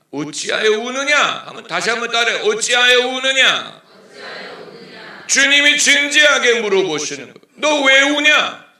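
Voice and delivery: full-volume preacher's call; preaching at full force. Acted. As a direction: cry it out from the pulpit. Preacher calls Jesus' question.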